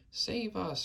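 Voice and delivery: high panicked plea; terrified scream. A narrator's reading. Nightmare-stricken giant begs for rescue, high terrified cry. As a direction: cry for help in high terror. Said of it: "screamed the flesh lump eater".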